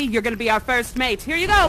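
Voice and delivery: normal talking voice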